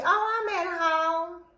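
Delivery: slow, casual fake innocence; a hint of him playing it cool; lightly voiced lying boyfriend. Boyfriend lying to her on the phone, lightly voiced casual. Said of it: "He tell me".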